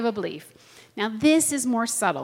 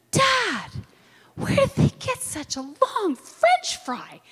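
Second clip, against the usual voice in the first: put-on high, eager little girl asking her dad; a wide-eyed eight-year-old, amazed; child's voice; 10 semitones higher, slower than usual. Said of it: "And I'm like".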